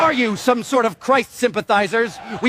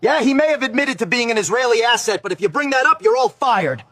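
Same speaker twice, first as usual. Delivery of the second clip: cranky boss; 6 semitones higher than usual. Acted. Cranky producer.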